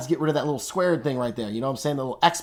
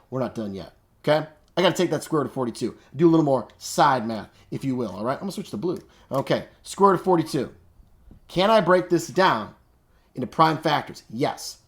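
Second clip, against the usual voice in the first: ordinary talk, nothing marked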